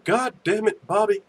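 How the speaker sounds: mock-exasperated character catchphrase; Hank Hill. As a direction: scold the boy in a put-on twang. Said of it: "That's my favorite saying".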